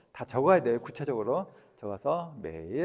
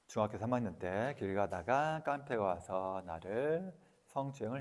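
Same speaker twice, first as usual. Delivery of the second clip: reciting an affirmation; 3 semitones lower, 6 dB quieter than usual. Shown as a demonstration. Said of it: "이렇게 해도 돼".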